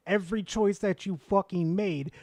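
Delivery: ordinary talk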